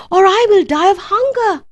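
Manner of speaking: high, loud dramatic plea; pleading. A narrator's reading. Hungry Jack begs the tall woman for food, desperately. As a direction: beg as if starving.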